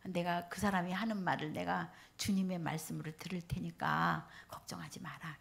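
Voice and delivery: soft, hushed and calm, near a whisper; calm reassurance. Retold or imitated. Mother soothing her worried son, soft and calm. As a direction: quietly settle your son down. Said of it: "그래서 내가".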